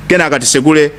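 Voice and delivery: ordinary delivery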